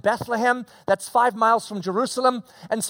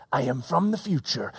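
lower, softer time traveler; spooky sci-fi stranger line; low movie voice; 8 semitones lower, 4 dB quieter than usual. Pretend.

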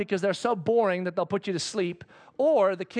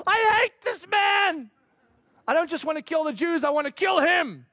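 mock rage; 7 semitones higher, 3 dB louder than usual. Haman raging to wife.

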